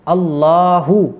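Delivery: slow, careful अल्लाहु with a clear final u; correct Allahu. Shown as a demonstration. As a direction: stretch out अल्लाहु, every sound distinct. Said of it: "अब सही word क्या है". (अल्लाहु धीरे और साफ़, आख़िर में 'उ' के साथ)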